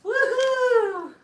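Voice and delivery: high loud woohoo; excited cheer. Real, not acted. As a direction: cheer at the top of your voice. Cheering game win.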